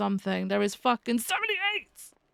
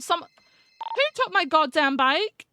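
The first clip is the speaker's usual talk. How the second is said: higher, louder call; yelling across the room; 6 semitones higher, 3 dB louder, slower than usual. Real, not acted. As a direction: call out about the stolen bike. Bike missing, calling out.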